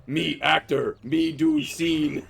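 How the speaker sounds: loud, slow caveman declaration; Bonk the caveman. Acted. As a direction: announce it like a proud caveman, stretching the words out. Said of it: "I was like".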